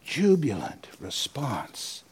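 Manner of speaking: slow whispered repeat of Jubilant response; whispered echo. Shown as a demonstration. Preacher echoing the quote to himself, slow whisper. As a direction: breathe the word Jubilant slowly, voiceless. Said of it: "It is Adam's jubilant response".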